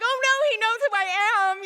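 high, loud panicked wail; childish panic. Retold or imitated. Girl realizes the priest knows her, loud high panic. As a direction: squeal in horror.

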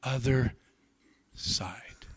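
"other side" said low, fading into a whisper on "side"; hushed emphasis on "other side"; dropped to a whisper. Shown as a demonstration. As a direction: let "other side" sink to a hushed, unvoiced "side". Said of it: "catch that little phrase".

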